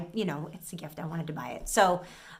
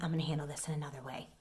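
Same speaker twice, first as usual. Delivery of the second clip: low, quiet and ominous, a bit fast; a quiet threat, lightly played; 5 semitones lower, 8 dB quieter, faster than usual. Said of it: "I sounded real ominous too".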